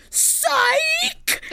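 high squealed mock Psych; mock [name] yelling psych. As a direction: shriek it like a taunting prankster. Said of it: "then spoke in the patois again".

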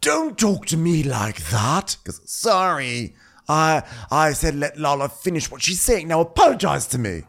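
mock Lisa scolding. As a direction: put him in his place. Voicing Lisa scolding Sandoval.

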